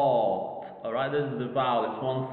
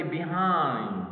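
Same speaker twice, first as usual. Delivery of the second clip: "behind" with weight on "hind"; stress on second syllable. Shown as a demonstration. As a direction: lengthen and stress the end of "behind". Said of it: "I stressed the second syllable".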